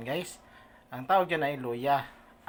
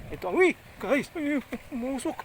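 alarmed exclaiming; 10 semitones higher, 4 dB louder than usual. Real, not acted. Pot catches fire.

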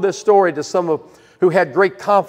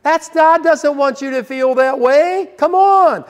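dismissive pastor; 6 semitones higher than usual. Retold or imitated. Pastor dismissing a troubled man, breezy and rising. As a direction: wave away the guilt with a breezy high Come on. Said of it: "the pastor would say".

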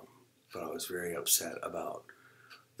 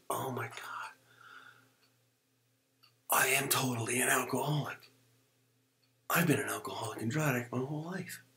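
raised pitch, dawning amazement; stunned realization; 6 semitones higher than usual. Retold or imitated. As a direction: let it hit you mid-breath. Acts out his sudden realization.

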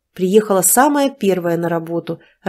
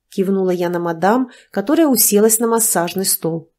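normal talking voice

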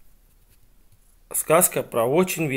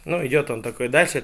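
plain reading voice

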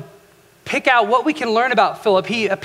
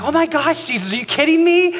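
intense disbelief; 6 semitones higher than usual. Retold or imitated. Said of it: "just intense about it".

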